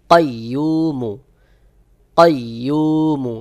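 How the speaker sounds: কাইয়ুমু with a hard, rounded qaf, slowly. Shown as a demonstration. Teacher showing students the hard qaf in কাইয়ুমু. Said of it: "শক্ত করে গোল মতো করে আওয়াজ হবে".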